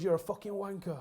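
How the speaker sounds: a manager's rant at a player, retold as a loud yell; retold angry yell. Fergie blasting Pallister after the match, in a mock yell. Manager rants in dressing room.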